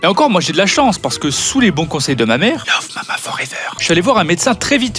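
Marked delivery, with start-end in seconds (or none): 2.7-3.5 soft unvoiced whisper. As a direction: breathe it like a secret. Whispered catchphrase.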